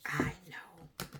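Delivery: breathy whispered echo; soft whispered repeat. Acted. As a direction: whisper it back like a secret.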